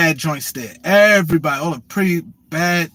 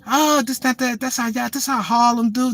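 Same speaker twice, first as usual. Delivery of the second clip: imitating her higher-pitched chatter; mock her rattling on about Harlem; woman talking Harlem; 5 semitones higher than usual. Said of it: "Now she talk this Harlem shit".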